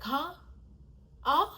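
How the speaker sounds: normal talking voice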